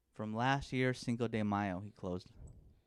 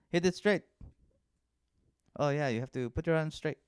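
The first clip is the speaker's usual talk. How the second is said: quick, higher, louder Mexican dad; Mexican accent; 5 semitones higher, 5 dB louder than usual. Retold or imitated. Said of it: "He's just like".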